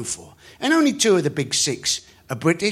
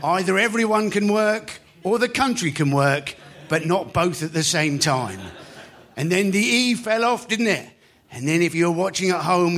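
ordinary talk

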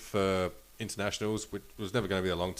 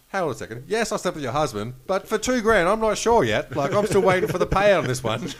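mocking waitress voice; 9 semitones higher, 8 dB louder than usual. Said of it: "just the fact that".

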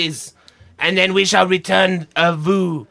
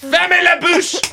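shouting; 10 semitones higher than usual. Acted. Guard snapping at mother.

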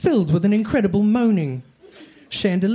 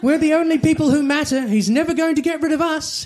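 much higher, defiant trio chanting together; proud heroes declaring in unison; chorus of heroes; 7 semitones higher than usual. A narrator's reading. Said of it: "said in chorus".

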